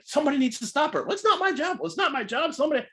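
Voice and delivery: high, fast, bickering voices; arguing staff. Retold or imitated. Sound guy and contest staff bickering, high-pitched argument. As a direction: squabble over whose job it is. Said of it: "arguing with one of the contest people".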